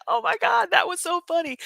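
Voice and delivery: high, laughing voice; giggly retelling. Retold or imitated. Retelling the flight attendant's reaction, giggly. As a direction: tell it laughing.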